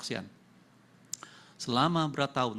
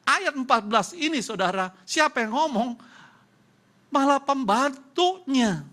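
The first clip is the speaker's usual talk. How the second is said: forceful preaching; 12 semitones higher, 8 dB louder than usual. Real, not acted. Preacher asks the congregation who spoke, loud preaching. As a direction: lean into it like a preacher calling out.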